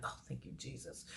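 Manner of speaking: a breathy whispered thank you Jesus; whispered relief. Retold or imitated. To the nurse offering a sponge bath, a whispered thankful aside. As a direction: sigh out relief under your breath.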